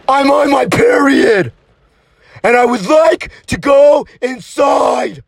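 put-on angry girlfriend losing patience; fed-up outburst; exasperated shout. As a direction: shout it in measured, emphatic chunks. Girlfriend snaps at boyfriend.

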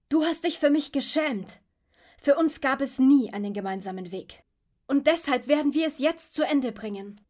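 loud, high bitter accusation; angry shouting. Acted. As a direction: shout it out in raw anger. Son confronts father in battle.

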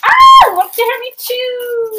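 very high excited squeal. Acted. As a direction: squeal it gleefully.

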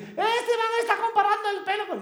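very high, loud mock complaint; imagined outraged critics. Guest imitating listeners who will object, mock-outraged. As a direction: squawk it like scandalised listeners, exaggerated. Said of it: "Porque van a decir".

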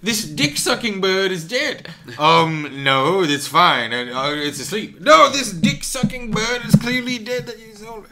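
hammed-up dead parrot complaint; mock Python sketch. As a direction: complain about the dead bird, Python style. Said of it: "famous Ministry of Silly Walks".

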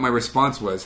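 ordinary delivery

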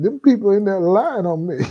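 high, whiny-comic protest of innocence; aggrieved devil. Retold or imitated. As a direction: play a wronged devil pleading his case. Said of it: "wiped his tears and".

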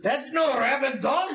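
high-pitched, excited dwarf; dwarvish accent. Acted. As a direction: exclaim it as a stock fantasy dwarf. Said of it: "you kinda catch this dwarvish accent".

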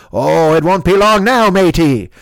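slow, hammed-up pirate drawl; playful pirate, stretching the words. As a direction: tease like a coy pirate. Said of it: "Why are you talking like a pirate now?".

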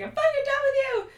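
high, fast mock yell. Castmate getting up, done with her friend, yelling. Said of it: "She starts yelling".

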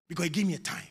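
ordinary talk, nothing marked

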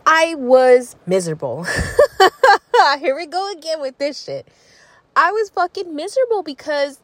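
laughing through the words; giggling while complaining. Real, not acted.

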